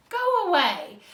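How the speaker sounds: loud high annoyed neighbours; angry shout. Acted. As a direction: shoo him away. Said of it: "they cried".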